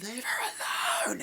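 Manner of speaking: high strained mock scream; imitated scream. Film heroine screams at the attacker, high strained yell. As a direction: cry out in a high, strangled voice.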